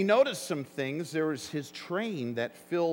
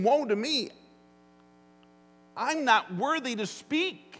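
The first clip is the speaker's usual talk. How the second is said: higher, pleading cry of unworthiness; Isaiah's lament; 6 semitones higher than usual. Retold or imitated. Preacher voicing Isaiah's cry of woe. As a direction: plead like a broken prophet. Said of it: "Isaiah is saying".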